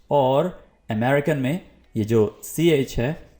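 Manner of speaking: ordinary talk, nothing marked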